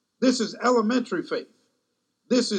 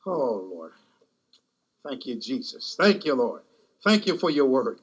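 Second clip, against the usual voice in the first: closing prayer; 5 semitones lower than usual. Real, not acted. Preacher blessing those assembled, in low prayer.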